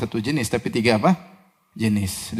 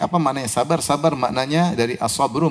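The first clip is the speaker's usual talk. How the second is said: normal talking voice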